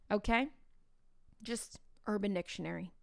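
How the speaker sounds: usual speaking voice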